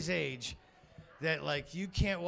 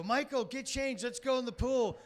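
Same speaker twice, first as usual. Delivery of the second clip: yelling upstairs; 8 semitones higher than usual. Retold or imitated. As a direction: call the kid down from another floor. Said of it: "I yell upstairs".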